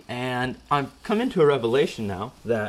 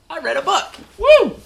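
high excited whoop over reading a book; mock excitement; 6 dB louder than usual. Host hyping his reading a book with a whoop. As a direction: celebrate it like a huge feat.

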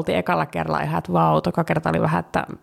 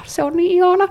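high, louder burst of adoration; gushing delight; 11 semitones higher, 5 dB louder than usual. Real, not acted. Co-host gushing over a beloved Turku restaurant, delighted. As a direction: gush over it, pitched way up.

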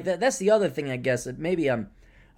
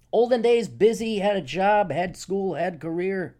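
normal talking voice

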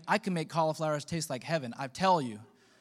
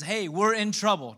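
urgent raised rally cry; rallying call; 5 semitones higher, 6 dB louder than usual. Retold or imitated. Nehemiah rallying his people, rousing call. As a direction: raise it, higher and louder, to rally them.

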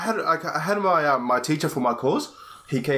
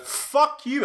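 high, pitched-up retort; mock angry retort; 12 semitones higher than usual. Retelling snapping back at his teacher, mock angry. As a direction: fling it back at him. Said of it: "I'm like".

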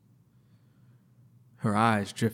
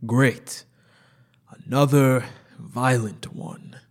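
louder, scornful doctor; contemptuous sneer; 2 semitones higher, 5 dB louder, slower than usual. Acted. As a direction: sneer it at the patient.

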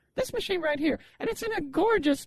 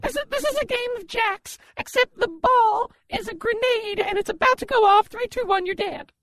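louder, higher countdown; excited sales pitch; 5 semitones higher, 4 dB louder than usual. Acted. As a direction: hype it like a TV seller.

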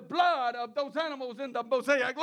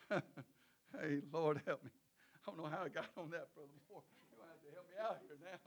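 muttered aside; 9 semitones lower, 16 dB quieter, faster than usual. Real, not acted. Muttered aside to Brother Moore.

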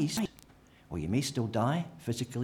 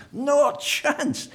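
much higher and louder; emphatic; 9 dB louder than usual. Real, not acted. Preacher flatly refusing the idea, high and loud. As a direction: bark it out firmly. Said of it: "emphatic way".

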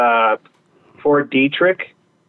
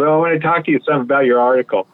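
hurried boss; 3 semitones higher, much faster than usual. Retold or imitated. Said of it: "He goes".

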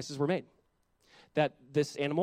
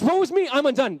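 higher and louder, crying out; impassioned cry; 9 semitones higher, 9 dB louder than usual. Acted. Preacher voicing Isaiah before God, crying out. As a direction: lift it into a full preaching cry.

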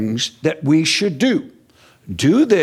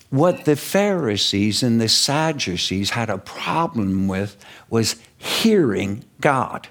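ordinary talk

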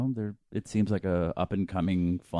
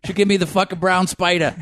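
put-on Boston accent; 11 semitones higher, 6 dB louder than usual. Sox fan voice, far timbre.

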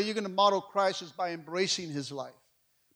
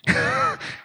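breathless laugh; 4 dB louder than usual. Real, not acted. Laughing after his story.

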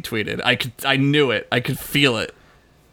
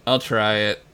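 ordinary talk

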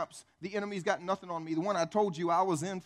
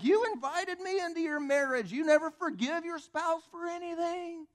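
high, loud incredulous jeer; hammed-up mocking protest; Satan; 12 semitones higher, 4 dB louder than usual. Devil telling believer who invited him, high mocking protest. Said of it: "Satan's like".

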